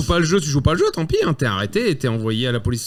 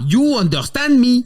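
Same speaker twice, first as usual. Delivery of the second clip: raised, mock Chinese-accented English; 8 semitones higher, 5 dB louder than usual. Police chief questioning the captive, mock Chinese accent. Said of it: "un accent chinois en anglais".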